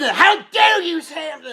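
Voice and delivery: mock rage at a comforting friend; mock furious outburst. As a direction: fake a furious snap at a friend. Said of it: "And I rip it".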